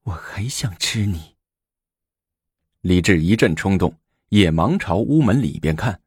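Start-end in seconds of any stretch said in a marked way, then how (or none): 0.0-1.4 breathy secret whisper right at his ear. Acted. Lady whispering into the emperor's ear, whispered. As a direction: whisper it close, just for him. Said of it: "在他耳边小声说".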